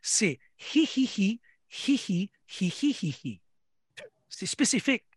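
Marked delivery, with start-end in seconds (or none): 0.7-3.4 wheezy cartoon dog snicker; Scooby-Doo laugh. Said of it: "le rire à Scooby-Doo". (rire de Scooby-Doo)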